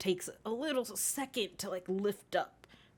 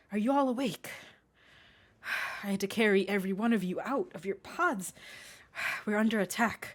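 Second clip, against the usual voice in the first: urgent rush; faster than usual. Acted. Captain urgently briefs crew.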